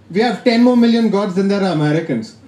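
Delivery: normal talking voice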